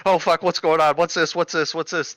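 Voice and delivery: high, excited mock chatter; all excited. Staff rushing to a rare radio call, all excited. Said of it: "they're all excited".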